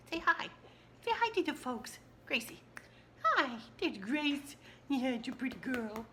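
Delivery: talking to the dog. Real, not acted. Vlogger greeting her little dog Gracie in high pet talk. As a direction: coo at the puppy.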